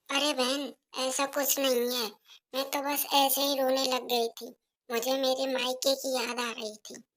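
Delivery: put-on soft, timid young wife offering tea; softly offering tea to her mother-in-law; meek daughter-in-law. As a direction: hand over the tea quietly and politely.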